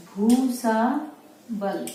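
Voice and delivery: Bhusawal stretched very slowly; slow dictation. Shown as a demonstration. Teacher showing how to write Bhusawal, very slowly. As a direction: lay out Bhusawal sound by sound. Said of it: "तो कैसे लिखा".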